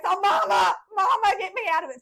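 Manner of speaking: put-on high, loud kid's wail for mama; child's desperate shout. Little girl in a neck brace yelling to her mother, desperate shout. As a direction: yell for mama to get you free. Child pleading after faking paralysis.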